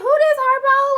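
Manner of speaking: high, loud, baffled question. Retold or imitated. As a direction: shout it in mock confusion. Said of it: "at first I was like".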